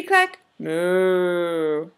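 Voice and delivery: ordinary delivery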